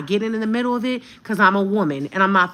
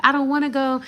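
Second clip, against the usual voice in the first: put-on higher pleading toddler voice; child voice; 7 semitones higher than usual. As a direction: whine like a little boy. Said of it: "he's like".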